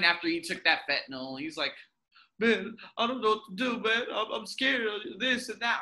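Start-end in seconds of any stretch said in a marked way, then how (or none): 2.4-5.8 lightly voiced whiny addict, a touch higher; a hint of the scared druggie's voice; mock-whiny plea from the addict on the news; imitating the crying addict. Voicing the crying drug user.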